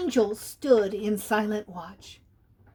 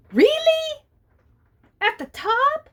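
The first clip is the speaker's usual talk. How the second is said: amazed cat; 13 semitones higher, 4 dB louder than usual. A narrator's reading. Benny amazed at reindeer's story.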